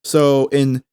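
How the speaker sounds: ordinary delivery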